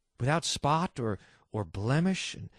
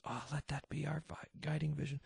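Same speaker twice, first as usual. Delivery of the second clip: hushed aside; 12 dB quieter than usual. Real, not acted.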